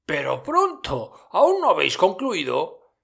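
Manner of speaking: mucho más agudo, festivo; Fezziwig jovial. A narrator's reading. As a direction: anuncia la fiesta con alegría. Fezziwig apremia a los jóvenes. (Fezziwig a sus aprendices, agudo y festivo)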